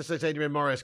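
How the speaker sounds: ordinary talk, nothing marked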